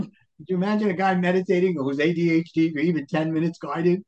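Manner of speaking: ordinary delivery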